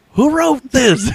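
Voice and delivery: high angry shout. Retold or imitated. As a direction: yell it across the office. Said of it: "I just shouted it out".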